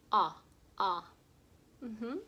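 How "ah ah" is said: short "Ah, ah" vowel demo, a touch louder; short O sound. Shown as a demonstration. Teacher demonstrates the short o to kids. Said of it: "What's the short O sound?".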